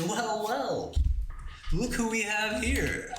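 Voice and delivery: high, slow mock villain. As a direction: purr it like a cartoon villain. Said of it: "And I'll say".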